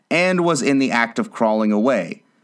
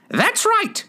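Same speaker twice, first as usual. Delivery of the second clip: very high queenly screech; piercing, high royal shout; shouted approval. A narrator's reading. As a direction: shriek it imperiously. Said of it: "shouted the Queen".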